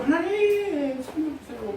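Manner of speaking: high, slow, drawn-out plea to God, then fading; mock pleading prayer. Pastor voicing his dawn prayer, drawn-out high plea. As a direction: imitate a desperate little prayer at dawn.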